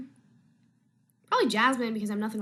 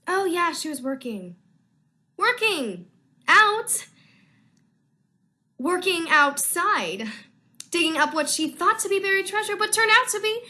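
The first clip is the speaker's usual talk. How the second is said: put-on higher, louder, girly character voice; a much higher and louder, more girly voice; Jasmine voice; 7 semitones higher, 7 dB louder than usual. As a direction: slip into the bubbly Jasmine voice for her line. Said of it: "I'm gonna try to do the Jasmine voice".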